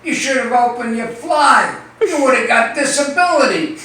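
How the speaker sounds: wife scolding her husband, high and loud. Acted. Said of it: "She says".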